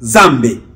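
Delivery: Zambé, loud, with a closed "é" ending; prononciation congolaise. Shown as a demonstration. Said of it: "chez les Congolais". (prononciation congolaise de Zambé, forte, finale en « é »)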